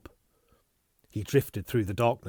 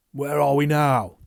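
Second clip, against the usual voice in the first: urgent question; 5 semitones higher, 9 dB louder than usual. Acted.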